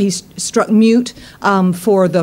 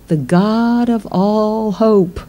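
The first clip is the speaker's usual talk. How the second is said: slow reading; much slower than usual. Real, not acted. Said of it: "What does the Bible say?".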